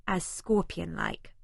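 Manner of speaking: plain reading voice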